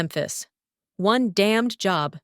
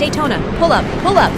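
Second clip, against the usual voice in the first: loud, high, frantic yelling; desperate shout; 7 semitones higher, 9 dB louder, faster than usual. Acted. Leader screaming at her falling wingman, desperate. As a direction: yell it as her plane goes down.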